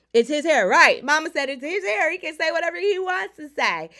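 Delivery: emphatic loud defense. Real, not acted. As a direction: raise your voice to make the point. Defending him, loud and high.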